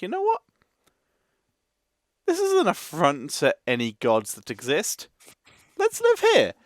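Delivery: high-pitched mock decisive humans; gleefully absurd, high-pitched proclamation; mock settlers. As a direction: imitate foolish settlers, voice jumping way up. Acting out humans picking bad places.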